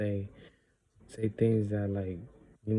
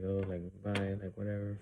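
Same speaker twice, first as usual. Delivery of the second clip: imitating a grudging, offhand bye, a bit softer; reluctant bye; 5 dB quieter than usual. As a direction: toss off the goodbye like it costs you. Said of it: "not even wanting to, like, talk".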